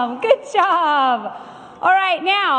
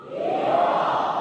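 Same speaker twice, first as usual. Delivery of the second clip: whooped yeehaw cheer; 4 dB quieter than usual. Acted. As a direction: holler it like a cowboy. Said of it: "Can I get a yeehaw?".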